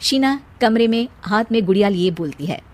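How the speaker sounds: plain reading voice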